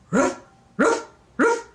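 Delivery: high, loud imitated barks; dog bark. Grandma imitating the pull-string toy's dog bark. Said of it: "The dog goes".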